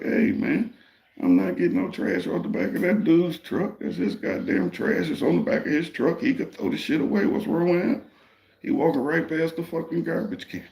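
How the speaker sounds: indignant retold complaint; heated retort. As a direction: vent at the coworker, worked up. Retold heated refusal to coworker.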